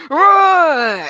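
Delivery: very loud high roar burst; kid's roar. Retold or imitated. Grandson roaring right after recording stopped, huge loud roar. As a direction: let rip a child's lion roar at full volume. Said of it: "he said".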